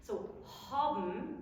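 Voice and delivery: haben said as "habem", lips closed into a nasal; slow "haben" ending on a hummed m. Shown as a demonstration. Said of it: "spelled B N but pronounced B M".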